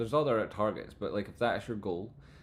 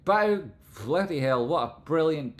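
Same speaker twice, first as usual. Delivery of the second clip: excited; 7 semitones higher, 8 dB louder than usual. Real, not acted. Exclaims at the donation.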